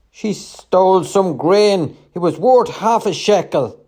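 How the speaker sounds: high, loud protest; indignant accusation. A narrator's reading. Trader answering what the woman stole, indignantly. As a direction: complain loudly with swooping pitch.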